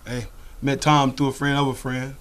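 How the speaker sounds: usual speaking voice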